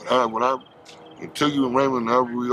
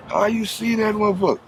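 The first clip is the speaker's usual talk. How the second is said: retold amazement; 10 semitones higher than usual.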